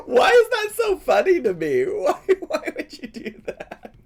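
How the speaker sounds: laughing. Real, not acted. Laughing at the comment.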